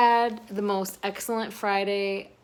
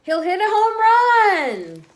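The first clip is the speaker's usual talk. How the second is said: much higher, much louder, slow triumphant cheer; excited cheer; 14 semitones higher, 14 dB louder, slower than usual. A narrator's reading. Cheering the dog's home run for the children, a big slow shout. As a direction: cheer it out like the crowd at the ballpark. Climax of baseball scene.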